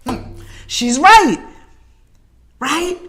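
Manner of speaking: slow, drawn-out reluctant agreement; slow realisation. Retold or imitated. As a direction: let it dawn on you slowly. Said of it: "I was like".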